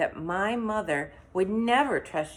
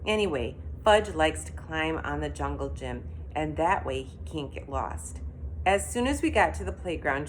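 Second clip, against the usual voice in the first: ordinary delivery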